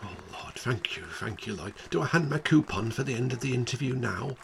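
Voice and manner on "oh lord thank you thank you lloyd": much softer, overcome gratitude; breathless thanks. Acted. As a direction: whisper thanks in relief. Grateful caller, very quiet.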